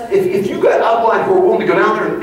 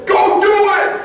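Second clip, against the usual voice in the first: exasperated shout; 14 semitones higher, much slower than usual. Retold or imitated. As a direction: snap back, fed up with the questions. Exasperated reply to endless questions.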